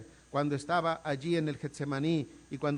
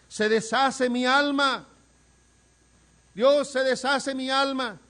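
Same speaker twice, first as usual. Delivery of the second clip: high, loud and slow anguished cry; anguished lament; 7 semitones higher, 8 dB louder, slower than usual. Acted. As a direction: pour out a soul coming apart. David clama que su alma se deshace. (lamento angustiado, alto, fuerte y lento)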